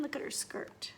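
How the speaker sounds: soft, hushed; whispered aside. Real, not acted. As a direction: point it out under your breath. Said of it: "aside to the child".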